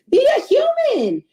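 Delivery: be a human, sung out high and loud; high, loud rallying plea; emphatic plea. Real, not acted. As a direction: throw it at them like a rallying cry. Emphatic urging to audience.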